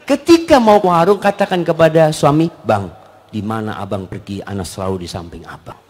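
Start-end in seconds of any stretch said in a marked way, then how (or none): 2.7-5.8 low, soft tender murmur; sweet, devoted wife. Acted. Wife promising to stay by husband's side, tender. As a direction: coax him tenderly, voice dropped and soft.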